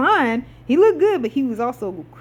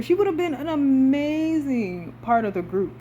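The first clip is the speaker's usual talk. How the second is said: ordinary delivery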